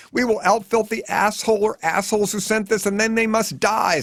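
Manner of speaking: high, strained mother's scream; screaming Mom. A narrator's reading. Mom vows revenge on the sender, screaming. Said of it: "screams Mom".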